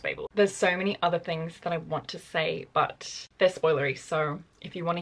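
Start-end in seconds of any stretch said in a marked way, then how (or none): 0.0-0.3 Babel said as "BAY-bul"; Babel pronounced to rhyme with "table"; non-American pronunciation. Shown as a demonstration. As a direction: say Babel with a long a, as in "baby". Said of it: "in the audiobook, it's pronounced".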